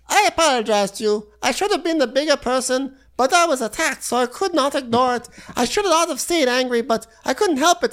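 very high squeaky put-on voice; squeaky fake-sweet apology; Fatum voice.

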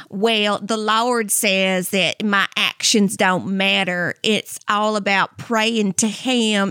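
slow, drawn-out Southern drawl; mock a preachy Southern twang; pious Southern woman. Jobless woman excusing herself by faith in slow Southern drawl.

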